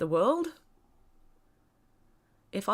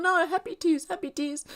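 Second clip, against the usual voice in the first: high-pitched mock crying protest; hammed-up teary voice; mock tearful; 11 semitones higher than usual. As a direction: squeak it out like you're bawling. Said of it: "And I'd be going".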